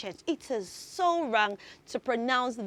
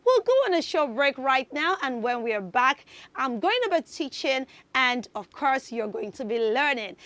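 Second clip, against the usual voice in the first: usual speaking voice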